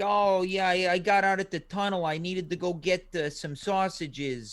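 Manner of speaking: lightly done New York accent, slowing at the end; a loose, simple New York guy; easy New York accent, not nailed. Shown as a demonstration. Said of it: "like, a New York accent".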